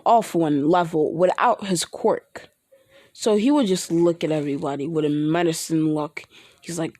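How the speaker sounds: usual speaking voice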